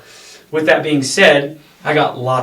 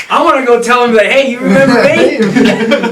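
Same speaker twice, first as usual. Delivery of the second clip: laughing mock taunt; 11 semitones higher, 9 dB louder than usual. Joking about confronting the ranger.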